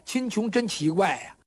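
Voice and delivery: usual speaking voice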